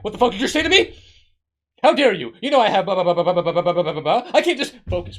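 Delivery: hammed-up furious outburst, high and very loud; mock yelling. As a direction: blow up at the person telling you off. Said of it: "lower your voice".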